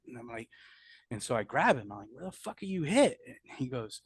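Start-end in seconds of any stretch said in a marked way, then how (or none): 2.1-3.2 much higher, frantic question; frantic demand. Retold or imitated. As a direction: grab him and demand an answer. Frantic demand to wounded soldier.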